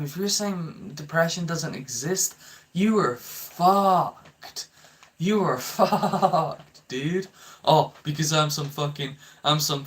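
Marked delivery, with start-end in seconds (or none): 2.7-6.9 slow, high, punched you are fucked; mocking slow emphasis; emphatic verdict. Real, not acted. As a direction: drag out each word with a laugh.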